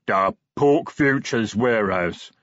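slow, heavy-tongued troll voice; lumbering troll messenger. A narrator's reading. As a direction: plod through it word by word. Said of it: "said the troll".

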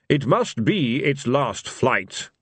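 slow, cold divine voice; stern, slow god voice. Acted. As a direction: state it slowly, coldly final. Fate insisting.

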